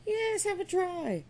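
high, slow coaxing voice; egging on. A narrator's reading. Surplice maker egging him on.

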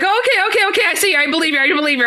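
high-pitched, hurried mock surrender; imitating startled, frantic agreement; retold alarm. To the being that just appeared, alarmed agreement. Retelling shock at the creature appearing.

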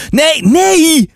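high, urgent calling out; alarmed cry. A narrator's reading. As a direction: cry out to stop someone. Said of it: "riep ik uit".

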